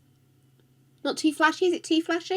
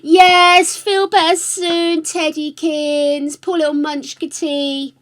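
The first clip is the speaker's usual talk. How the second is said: cooing sympathy; 6 semitones higher, 4 dB louder, slower than usual. Real, not acted. Streamer wishing poor little Teddy better, cooing. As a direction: coo a get-well wish, stretching words. Get-well wish for sick Teddy.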